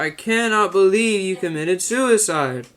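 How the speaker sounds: slow, high, stilted line reading; Neil Breen impression. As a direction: overact it like a bad film. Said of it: "He's like".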